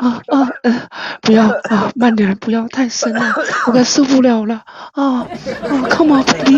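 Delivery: put-on pleading moans between short phrases; hammed-up breathless pleading; mock moaning. Streamer doing a forfeit for co-hosts, mock moaning.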